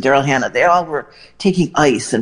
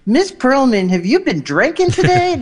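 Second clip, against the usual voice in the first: much higher, playful put-on voice; imitating Dolly; 9 semitones higher than usual. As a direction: tease her in a much higher, sparkly voice. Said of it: "and Dolly goes".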